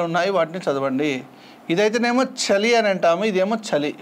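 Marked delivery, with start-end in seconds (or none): none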